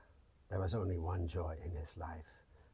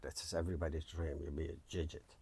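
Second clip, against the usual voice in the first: usual speaking voice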